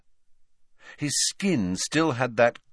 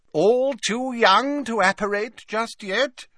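high, slow, jovial character voice; genial Slughorn; 4 dB louder, slower than usual. A narrator's reading. Professor greeting his three remaining students, genial. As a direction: draw it out with hearty good humour. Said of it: "said Slughorn genially".

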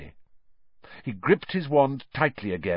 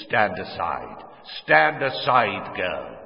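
a hint of a menacing dark lord; slow, icy order to step aside; lightly voiced villain commanding; cold command; slower than usual. A narrator's reading. Voldemort orders the mother away.

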